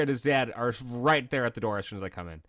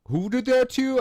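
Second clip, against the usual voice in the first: much higher and louder, a retold dad's outrage; angry dad demand; 13 semitones higher, 8 dB louder than usual. Friend's dad confronting the boy, mock outraged.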